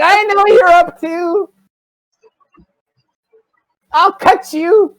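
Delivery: high, loud, drawn-out mock threat; threatening child. Child threatening the old lady with a knife, slow high threat. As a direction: menace slowly in a high little voice.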